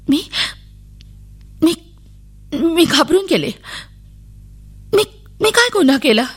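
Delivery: put-on high, scared little girl's voice; a frightened girl pleading innocence; frightened child. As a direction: ask it like a terrified child. Frightened child questioned by police.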